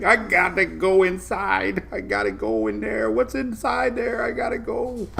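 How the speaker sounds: slow, far-off hushed voice of longing; drawn in like a moth to the door; mock urge to go inside. A vlogger hams up the pull of beautiful brick buildings. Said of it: "It's like".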